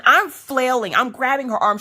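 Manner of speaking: high-pitched, frantic report; acted-out panic, pitched high; frantic retelling. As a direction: tell it as if still flailing. Acting out panic during the swab.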